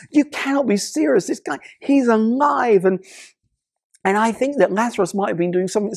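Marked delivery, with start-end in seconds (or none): 0.1-2.8 high, raised cry of disbelief; amazed onlooker. Retold or imitated. Onlooker stunned at Lazarus alive, high amazed exclamation. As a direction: exclaim it as if witnessing a miracle. Crowd sees Lazarus walk out.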